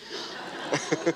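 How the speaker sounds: quiet unvoiced chuckle; real amused chuckle; soft breathy laugh. Real, not acted. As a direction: let a little laugh out. Audience poll result amuses her.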